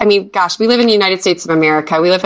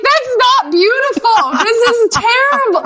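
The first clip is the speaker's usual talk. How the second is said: very high, slow, stretched-out mock wail; a high, slow imitation of someone aghast; mock distraught wail; slower than usual. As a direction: whine it out high and drawn, mocking the reaction. Mocking a reaction to bad news.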